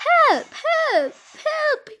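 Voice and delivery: very high, loud yelps; frantic storybook cries for help; shouting for help. A narrator's reading. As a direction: shout for rescue in short high bursts. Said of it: "he shouted".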